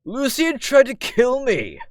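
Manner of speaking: loud, high, drawn-out cry; outraged accusation. Acted. Ernest accusing Lucian in the basement, high outraged shout. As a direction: wail the accusation at full pitch. Boy accuses another in story.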